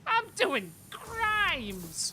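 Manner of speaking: very high, slow gleeful yell; gleeful shout. Acted. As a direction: stretch it out with glee. Acted exchange about crimes.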